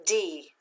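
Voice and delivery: D said alone, short, without stress; unaccented syllable. Shown as a demonstration. Teacher sounds out first syllable for students, D unaccented. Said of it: "read the first syllable without the accent".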